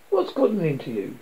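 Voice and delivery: usual speaking voice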